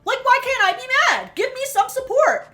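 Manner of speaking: high, loud indignant outburst; venting. Retold or imitated. Daughter venting to her sister about mom, indignant. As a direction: vent in a high-pitched, exasperated protest. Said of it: "She vents to her sister".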